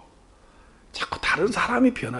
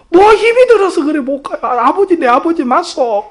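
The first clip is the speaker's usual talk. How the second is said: exasperated pleading; 7 semitones higher, 11 dB louder than usual. Retold or imitated. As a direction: argue with your father at full volume. Son quarrels with father on phone. (답답해서 언성 높여 따지듯)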